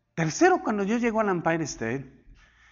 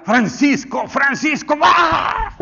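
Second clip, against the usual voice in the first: loud high shouted name, ending in a much higher yell; God calling out; 9 semitones higher, 7 dB louder, slower than usual. Acted. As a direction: call "Francisco" louder each time, then a sudden cry. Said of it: "y el Señor le diga". (grito fuerte y agudo de Dios llamando a Francisco)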